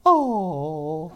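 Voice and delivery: mock toddler's long, drawn-out coo; long "oh" over a baby, imitated; imitating little granddaughter. Grandparent imitating Owen seeing a baby, drawn-out coo. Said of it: "And she'd go".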